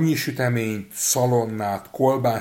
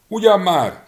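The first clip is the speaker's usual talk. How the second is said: high, loud cry; little man shrieking at the wolf; shrieked retort; 11 semitones higher, 10 dB louder than usual. A narrator's reading. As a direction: shriek back defiantly. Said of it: "rikkantotta a kisember".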